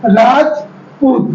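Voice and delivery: Rajput drawn out slowly, a break after राज; teacher sounding out a name for children; slow syllable split. Shown as a demonstration. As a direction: say Rajput slowly, pausing between राज and पूत. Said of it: "जैसे हमने यहाँ पे बोला".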